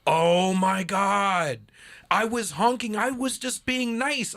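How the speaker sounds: high, loud, slow mock dismay; wife's horrified realization. Husband imitating wife realizing she honked at the wrong sign, high loud dismay. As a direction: drag out the shock of realizing she was fooled.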